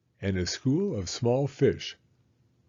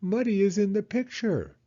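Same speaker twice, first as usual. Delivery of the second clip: hedgehog; 10 semitones higher than usual. Acted.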